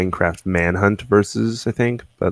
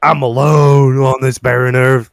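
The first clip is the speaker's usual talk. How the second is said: louder, line by line with pauses; one line at a time; 3 semitones higher, 6 dB louder than usual. Shown as a demonstration. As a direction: declaim each line on its own. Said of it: "one line at a time".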